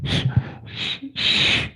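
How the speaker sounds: soft unvoiced shushing; hushing. Real, not acted. Streamer hushes chat with quiet shushing. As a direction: hush the room gently. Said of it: "hushing chat".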